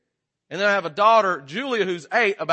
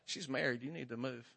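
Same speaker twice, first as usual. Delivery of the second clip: put-on much softer, hushed old lady whisper; old lady's warning; 5 semitones lower, 19 dB quieter than usual. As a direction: lean in and murmur a stern warning. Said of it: "little old lady ... was like".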